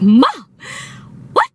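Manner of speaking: mock-stunned gasped exclamation; shocked disbelief. Daughter stunned that mom is cussing too, shocked. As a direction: blurt it with a gap before the second word.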